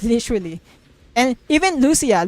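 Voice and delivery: ordinary talk, nothing marked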